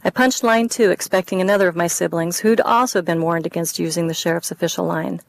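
usual speaking voice

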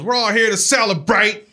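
imitating a pastor rallying the crowd; crowd-rousing pastor. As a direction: announce it grandly, hammed-up. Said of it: "he's gonna turn this crowd around".

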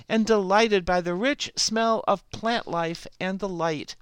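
ordinary delivery

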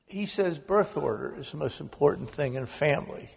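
usual speaking voice

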